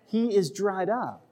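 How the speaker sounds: ordinary talk, nothing marked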